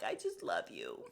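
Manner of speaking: very low, soft imitation; hushed, retold affection; tender declaration. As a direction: murmur it low to a partner. Tender speech to husband, retold.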